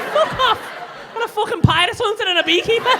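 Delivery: high, shouted mock astonishment; loud disbelief. Comic retelling his reaction to beekeeping, in loud incredulity. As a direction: blurt it out in loud, laughing disbelief.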